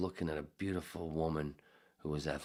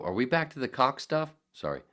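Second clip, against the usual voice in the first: ordinary talk, nothing marked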